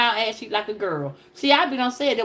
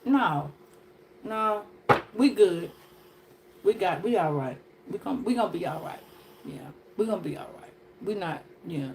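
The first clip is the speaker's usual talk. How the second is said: fading softer, mock soothing self-talk; fading to quiet reassurance; 6 semitones lower, 6 dB quieter than usual. Comic calming the crowd after a rant, trailing softer. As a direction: talk yourself down, quieter each time.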